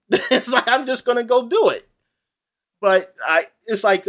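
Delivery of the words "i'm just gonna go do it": usual speaking voice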